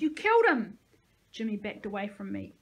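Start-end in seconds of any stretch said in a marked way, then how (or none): 0.0-0.8 high, loud accusing scream; panicked scream. A narrator's reading. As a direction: shriek the accusation. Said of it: "Jimmy screamed".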